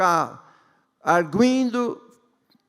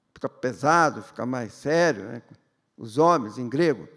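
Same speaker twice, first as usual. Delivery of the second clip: ordinary delivery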